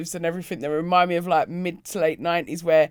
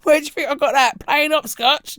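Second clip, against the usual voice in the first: loud, higher, cocky pub threat; mock hard-man shout; 7 semitones higher, 6 dB louder than usual. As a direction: square up and bark it at them. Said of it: "I'm like".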